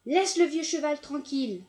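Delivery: loud scolding yell; angry shout. A narrator's reading. Farmer yelling at the dog to leave the horse, angry. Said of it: "vociféra-t-il".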